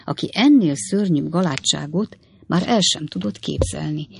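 ordinary delivery

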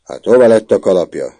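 loud stress on hova, falling; demanding prefect. A narrator's reading. Prefect demands about hat.

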